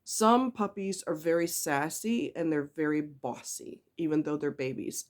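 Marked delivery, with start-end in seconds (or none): none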